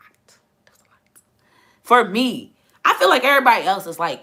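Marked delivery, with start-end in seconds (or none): 0.1-1.0 unvoiced whisper, trailing away. Real, not acted. Reflecting on an overwhelming day, whispered. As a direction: breathe it out, spent. Echo after yesterday was a lot.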